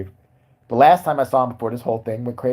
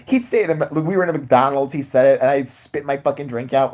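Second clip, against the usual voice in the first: usual speaking voice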